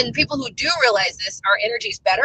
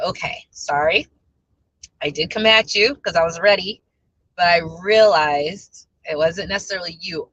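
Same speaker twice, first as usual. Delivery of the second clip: slow, lower-pitched retold apology; calmed down; 4 semitones lower than usual. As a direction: explain it calmly, taking your time. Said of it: "and I calmed down".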